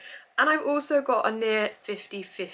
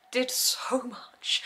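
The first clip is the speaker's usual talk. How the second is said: whisper; 7 semitones higher, much slower than usual. Real, not acted. Whispered gush about loved book.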